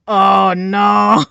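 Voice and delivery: a loud groan of Oh, no; loud dismay. Real, not acted. Dread at the hatch.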